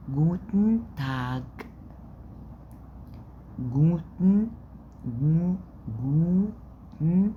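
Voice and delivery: "جوتن" stretched very slowly; slow German drill. Shown as a demonstration. Teacher breaking greeting into slow syllables for class. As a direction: draw out "جوتن" sound by sound. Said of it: "الج في الآخر بتتنطق ك".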